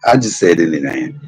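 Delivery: normal talking voice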